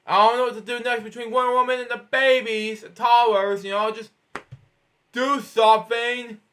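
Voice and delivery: plain reading voice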